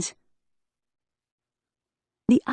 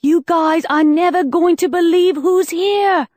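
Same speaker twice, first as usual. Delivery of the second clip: high, loud, thrilled announcement; excited cry; 8 semitones higher, 4 dB louder than usual. Acted. Bex shouts excitedly into the earpiece to her friends. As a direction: burst out with the big news. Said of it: "as Bex cried".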